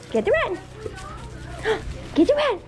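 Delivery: high, loud coaxing call to a dog; squeaky praising a dog. Real, not acted. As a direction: sing out to the dog.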